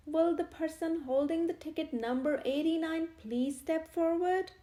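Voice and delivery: loud public call; vicar calling out. A narrator's reading. Vicar repeats the call to the crowd, loud. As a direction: project it to the back of the crowd.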